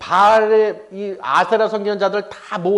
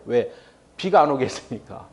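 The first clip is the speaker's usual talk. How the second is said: ordinary talk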